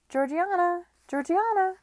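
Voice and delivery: high, loud calls up the stairs; high, loud calls of Georgiana's name; calling out. Acted. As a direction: shout for her friend through the house. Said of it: "she called".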